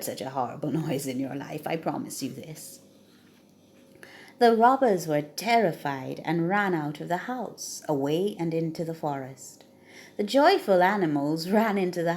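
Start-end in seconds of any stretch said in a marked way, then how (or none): none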